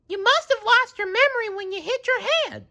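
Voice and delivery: very high concerned voice; explaining kindly; Sandy voice. A narrator's reading. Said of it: "said Sandy".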